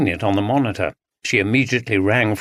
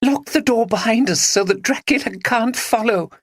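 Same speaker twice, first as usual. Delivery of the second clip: giggling; 11 semitones higher than usual. Acted. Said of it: "giggled Maggie".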